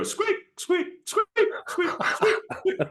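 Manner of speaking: very high squeaking imitation; high mock squeak sounds; squeaky plow noise. Shown as a demonstration. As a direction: squeak like rubber on ice. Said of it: "there's two options".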